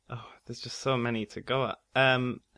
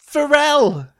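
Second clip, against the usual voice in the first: excited; 12 semitones higher, 10 dB louder, slower than usual. Real, not acted. Excited recognition of [name].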